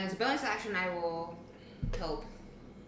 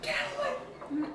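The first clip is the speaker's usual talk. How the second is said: fluttering cries for help. Acted. Panicked patrons flee the brute.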